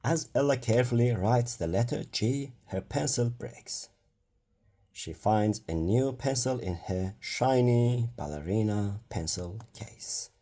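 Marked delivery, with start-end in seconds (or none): none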